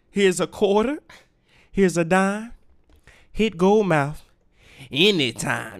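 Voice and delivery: player. Pretend. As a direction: drop the line like a smooth player. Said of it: "some real player shit".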